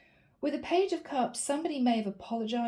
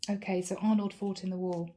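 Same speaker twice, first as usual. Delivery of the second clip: usual speaking voice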